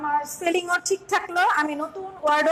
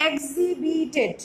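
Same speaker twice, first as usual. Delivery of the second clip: slow, each syllable of এক্সিবিটেড apart; slow syllable split of এক্সিবিটেড; much slower than usual. Shown as a demonstration. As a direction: model the spelling slowly. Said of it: "এইভাবে যখন আমি একটি word".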